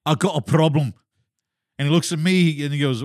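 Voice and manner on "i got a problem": raised pitch, put-on Scottish accent. Telling a friend he is stuck, Scottish accent. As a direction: do a Scottish brogue. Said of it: "going back and forth in a Scottish accent".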